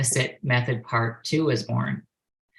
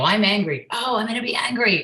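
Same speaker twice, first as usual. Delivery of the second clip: higher-pitched mock-angry outburst; acted anger; 7 semitones higher than usual. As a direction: play at being angry, pitch jumping up. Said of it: "have this feeling and I go".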